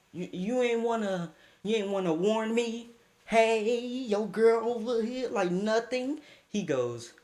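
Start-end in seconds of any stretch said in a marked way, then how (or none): none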